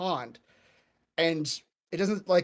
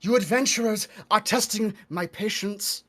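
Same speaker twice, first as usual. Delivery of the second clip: put-on loud, impatient old witch; angry old woman; 8 dB louder than usual. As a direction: snap at the adventurers. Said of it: "she storms out. She goes".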